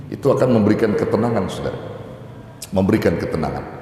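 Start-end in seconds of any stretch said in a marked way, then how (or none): none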